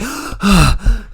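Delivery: mock out-of-breath gasp for air; hammed-up gasp mocking a winded wrestler; imitated gasp. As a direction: gasp like he can't catch his breath. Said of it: "He's out of breath".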